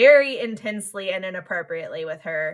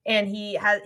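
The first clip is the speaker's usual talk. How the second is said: ordinary talk, nothing marked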